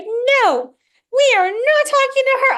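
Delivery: put-on very high, loud childlike refusal; the inner child refusing to speak to mom, high and loud; defiant inner child. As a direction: dig in like a scared stubborn kid. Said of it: "But my inner child was like".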